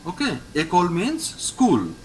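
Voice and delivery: ordinary delivery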